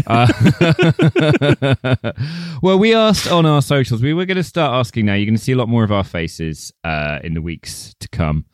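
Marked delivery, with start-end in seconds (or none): 0.3-2.6 high laugh. Real, not acted. Laughter after the joke.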